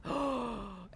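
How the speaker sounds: soft startled gasp; a quiet gasp of realization; mock shocked intake of breath. Retelling realizing she was contaminated.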